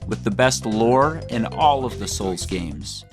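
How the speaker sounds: ordinary talk, nothing marked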